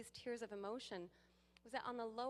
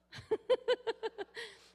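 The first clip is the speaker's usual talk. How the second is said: loud high laugh; real hearty laugh; big laugh; 13 semitones higher, 13 dB louder than usual. Real, not acted. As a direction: burst out laughing. Laughing at her own bit.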